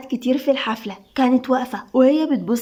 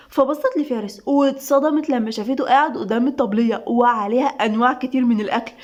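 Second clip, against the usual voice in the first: usual speaking voice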